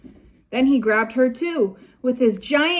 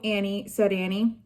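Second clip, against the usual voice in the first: ordinary delivery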